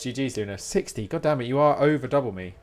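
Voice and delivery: normal talking voice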